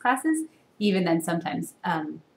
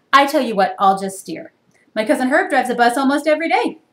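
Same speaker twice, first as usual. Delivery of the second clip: pigeon bargaining; 7 semitones higher, 9 dB louder than usual. Acted.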